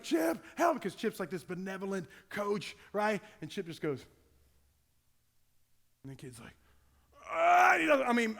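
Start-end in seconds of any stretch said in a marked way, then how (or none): 0.0-0.8 pleading cry. Retold or imitated. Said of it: "the kid was looking like". 7.3-7.7 loud, higher strangled yell from the gut; hellish scream. Retold or imitated. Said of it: "a noise from the pits of hell came out of the kid".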